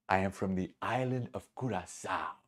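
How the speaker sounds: understated Curaçao accent, gently put on; Curaçao man's accent. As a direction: state it quietly in an attempted Curaçao accent. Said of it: "to honor this man's accent".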